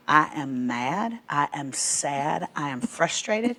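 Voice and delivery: slow, imitated child naming feelings; measured recital, one feeling at a time; deliberate listing. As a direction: spell out each feeling slowly. Child reads off her feeling chart.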